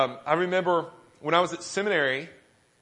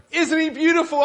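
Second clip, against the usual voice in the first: insistent proud father; 11 semitones higher, 7 dB louder than usual. Retold or imitated. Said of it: "he asked me a third time".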